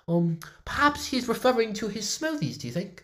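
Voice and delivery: very high-pitched British musing; high, put-on British voice; British accent. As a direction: muse aloud in a British accent. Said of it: "boss, your voice!".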